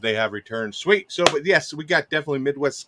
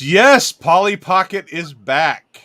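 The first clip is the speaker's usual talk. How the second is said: loud, high, slow cheer; excited chat quote; 4 semitones higher, 7 dB louder, much slower than usual. Retold or imitated. Host reads a viewer's chat as a slow, loud, high cheer. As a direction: read the chat line out like a cheer. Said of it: "Double S says".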